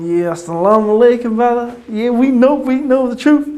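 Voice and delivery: high, loud eager greeting; loud high-pitched zealous excitement; hyped up. Retold or imitated. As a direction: fire everyone up to join. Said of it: "I was just going".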